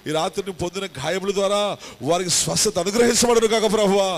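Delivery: from steady to loud, high pleading; building healing prayer. Acted. Pastor praying for the sick, rising to a loud cry. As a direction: swell into a fervent plea for healing.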